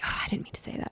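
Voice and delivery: regretful whisper. Retold or imitated. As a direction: breathe it out as a guilty afterthought. Regretful afterthought after an argument.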